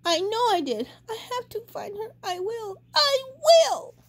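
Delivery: a small bird promising himself; determined baby bird. A narrator's reading. Baby bird vows to find his mother, high and building.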